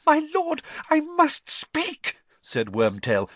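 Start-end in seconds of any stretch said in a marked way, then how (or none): 0.1-2.2 very high, urgent plea; frantic servant's outburst; panicked. A narrator's reading. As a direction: beg to be heard, voice shooting up. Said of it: "panic in his voice now".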